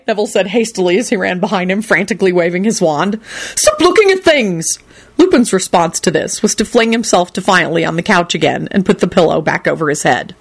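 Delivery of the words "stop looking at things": frantic shout. Acted.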